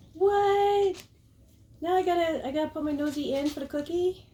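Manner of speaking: high, put-upon dog voice speaking for him; dog's voice. Pretend. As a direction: whine as the dog asking for the cookie. Voicing the dog's complaint.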